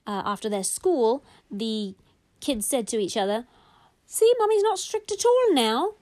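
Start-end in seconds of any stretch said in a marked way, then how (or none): 4.1-5.9 put-on higher, louder kid voice; children's voice. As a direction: chirp it like a child confiding in a sibling. Kids talking together in a story.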